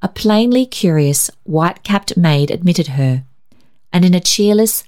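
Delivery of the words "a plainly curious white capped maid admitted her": plain reading voice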